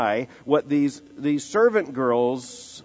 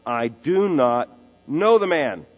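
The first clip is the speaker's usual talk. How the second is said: louder, slow, each word hammered; forceful denial; 3 semitones higher, 6 dB louder, slower than usual. Acted. Peter swearing to the bystanders that he doesn't know Jesus, loud and slow. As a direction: deny it with full force. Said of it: "he repeats the outright denial".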